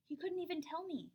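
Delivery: ordinary delivery, nothing marked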